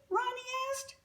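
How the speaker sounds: ordinary delivery, nothing marked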